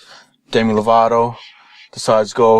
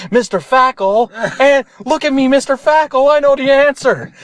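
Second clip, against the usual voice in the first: very high, pleading know-it-all voice; eager pupil; 14 semitones higher than usual. Acted. Pupil to teacher with the answer, over-eager squeal.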